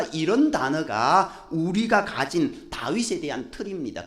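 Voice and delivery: ordinary talk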